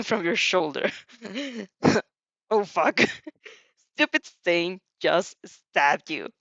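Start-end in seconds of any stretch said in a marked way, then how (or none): 1.2-2.0 real laugh. Real, not acted. Reader laughs at stab. 2.5-6.3 laughing. Real, not acted.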